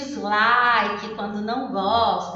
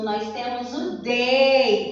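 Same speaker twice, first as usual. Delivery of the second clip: ordinary talk, nothing marked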